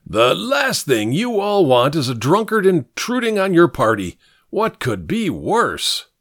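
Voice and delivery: theatrical scrounger, starting high; blustering old drunkard. Acted. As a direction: hold forth like a tipsy old man.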